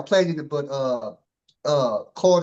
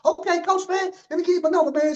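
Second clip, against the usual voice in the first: much higher and very fast; high rapid coach voice; 12 semitones higher than usual. Retold or imitated. Host imitating the coach offering his number, high and rushed. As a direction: fire it off like an excited coach. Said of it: "He said".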